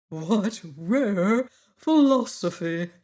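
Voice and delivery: slow, grand and admiring; admiring exclamation. A narrator's reading. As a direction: savour each word in wonder. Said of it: "exclaimed the Wogglebug admiringly".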